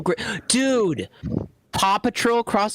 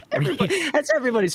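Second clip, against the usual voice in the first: laughing; 11 semitones higher, faster than usual. Real, not acted. Mock ant character love.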